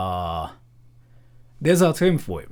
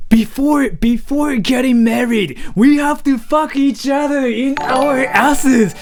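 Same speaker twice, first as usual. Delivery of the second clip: much higher, louder, slow creepy voice; 13 semitones higher, 9 dB louder, slower than usual. Acted. Streamer hamming up a creepy proposal to chat, loud high creepy voice. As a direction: leer it out high and loud, slowly. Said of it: "this is how to creep out people".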